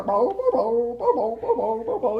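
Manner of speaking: playful bark, bo bo bo; dog barking. Acted. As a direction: bark like a friendly shaggy dog. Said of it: "Your bark".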